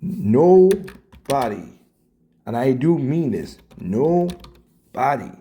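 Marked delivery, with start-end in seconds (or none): none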